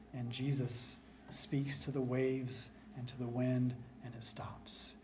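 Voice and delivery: hushed whisper as the storm stills; hushed whisper as the wind stops. Acted. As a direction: breathe it out, unvoiced. Calming of the storm.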